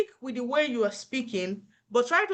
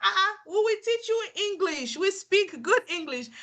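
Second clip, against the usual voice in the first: high, put-out "excuse me" to a doubter; indignant retort; 8 semitones higher than usual. Retold or imitated. Nurse snapping back at patients who doubt her English, indignant. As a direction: bristle with pride, pitched well up. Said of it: "I'm like".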